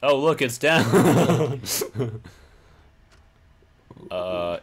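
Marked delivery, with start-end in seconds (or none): 0.1-1.6 high, loud, breaking into laughter; loud amused outburst; laughing. Real, not acted. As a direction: crack up as you say it. Laughing at the door kicked down.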